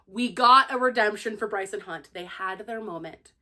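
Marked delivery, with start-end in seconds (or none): none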